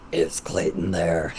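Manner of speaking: very deep, slow creature voice; freaky monster voice. Acted. Kid prank-calling friends in a deep, creepy monster voice. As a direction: creep them out with a huge low voice. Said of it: "freaking out my friends with that voice".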